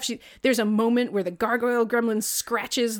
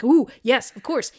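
higher, pleased "ooh" of approval; mock delighted approval; 4 semitones higher, 6 dB louder than usual. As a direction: coo approval like a smug executive. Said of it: "it's like".

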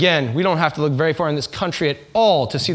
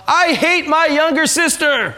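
mock yell; 10 semitones higher, 3 dB louder, slower than usual. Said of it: "she always yells out".

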